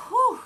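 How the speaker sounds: high, loud whew of relief from pressure; heavy whew. Real, not acted. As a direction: blow out a big, high "whew". Overwhelmed, lets out big breath.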